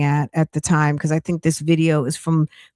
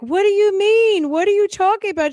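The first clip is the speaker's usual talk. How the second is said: high mock voice, baffled; mimicking Nesto; 14 semitones higher than usual. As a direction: play the confused husband in a high squeak. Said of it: "when he was like".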